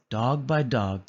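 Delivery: plain reading voice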